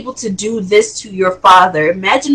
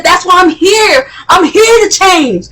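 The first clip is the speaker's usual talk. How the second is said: much higher, louder desperate yell; son yelling; 10 semitones higher, 5 dB louder than usual. Retold or imitated. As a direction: shout it back at your father. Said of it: "his son was yelling at him".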